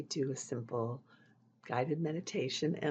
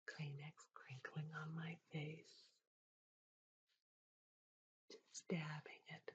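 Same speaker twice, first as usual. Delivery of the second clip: ASMR whisper; 2 semitones higher, 9 dB quieter than usual. Acted. ASMR host to viewer, dabbing Kleenex, soft whisper. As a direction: whisper gently to lull the viewer.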